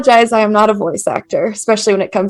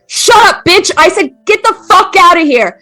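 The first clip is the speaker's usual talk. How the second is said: angry shouting man; 8 semitones higher than usual. Acted. Father screaming at mother and son, raging yell. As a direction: scream him down in rage.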